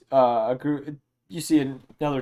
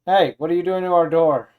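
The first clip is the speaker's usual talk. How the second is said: loud, higher challenge from a guard; shouting; 5 semitones higher, 10 dB louder than usual. Acted. Game master as guy behind intruder, shouting. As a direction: call out accusingly, raised voice. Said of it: "kinda start shouting".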